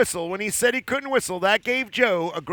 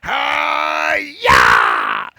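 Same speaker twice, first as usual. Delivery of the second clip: loud martial arts cry; karate yell; 4 semitones higher, 4 dB louder than usual. Acted. Giant smashing the tree, karate shout. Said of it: "and he went".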